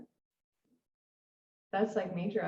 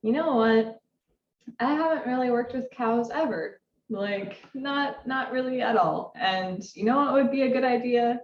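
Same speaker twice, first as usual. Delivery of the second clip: louder, higher self-talk in quotes; mock pep talk to self; 5 semitones higher, 6 dB louder than usual. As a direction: act out convincing yourself, brightly raised voice. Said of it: "I thought to myself".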